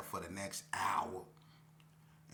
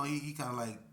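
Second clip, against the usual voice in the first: ordinary talk, nothing marked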